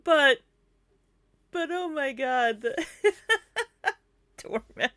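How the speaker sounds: high voice collapsing into laughter; fail to recover from the giggles; helpless laughing. Real, not acted. Still cracking up at the slip.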